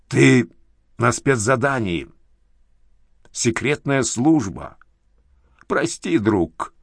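put-on thick-tongued drunken mumble; slurred drunk speech. A narrator's reading. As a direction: slur the words, tongue heavy. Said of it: "заплетающимся языком".

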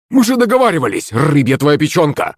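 indignant yell. A narrator's reading. Захарыч reproaching Акулыч for breaking their deal, yelling. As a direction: cry out in high-pitched protest. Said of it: "закричал Захарыч".

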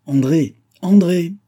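high, loud call, building on the repeat; father calling for his daughter. A narrator's reading. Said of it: "et qu'il appela".

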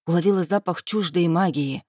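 plain reading voice

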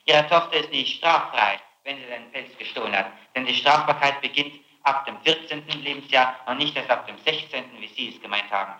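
usual speaking voice

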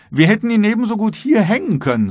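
high, loud, quickened officer's voice; joking guard. A narrator's reading. Officer jokes to the captain, high and loud. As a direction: toss it out loud and high as a joke. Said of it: "lachte über seine Bemerkung".

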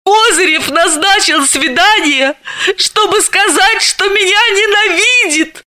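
a touch of a howl. Acted. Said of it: "провыла Далила".

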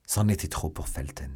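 ordinary delivery, nothing marked

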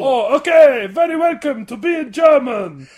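loud, high, hammed-up German accent; Rolf-style German accent, shouting; shouted German accent. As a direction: belt it out like a Muppet German. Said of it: "turned into Rolf from the Muppet Show".

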